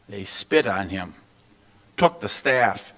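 ordinary delivery

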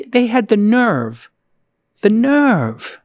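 slow, drawn-out repeat of the nerve; dwelling slowly on the nerve while ranting; indignant emphasis. Real, not acted. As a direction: lean on nerve in disbelief. Indignant rant about AWS.

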